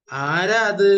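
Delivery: high, loud call of the dragonfly's question; loud asking. Shown as a demonstration. Teacher shows class the loud question, calling out. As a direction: ask it out loud so all can hear. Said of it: "ഒന്ന് ഉറക്കെ ചോദിക്കുക".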